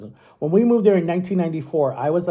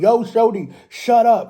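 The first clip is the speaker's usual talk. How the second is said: higher-pitched mock yell at a dog; imitated yell; 5 semitones higher, 4 dB louder than usual. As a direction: act out someone yelling, a bit louder. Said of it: "'Cause he's yelling".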